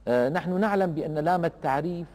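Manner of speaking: normal talking voice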